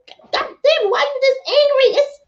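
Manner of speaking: high, disbelieving mock squeal; exaggerated high shocked voice; incredulous. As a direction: pitch way up, baffled at someone's morning rage. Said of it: "I'm like".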